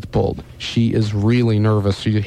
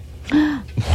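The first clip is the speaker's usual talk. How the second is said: high sharp gasp; astonished gasp; 4 dB quieter than usual. Acted. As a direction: gasp in amazement. Astonished at the bullseye.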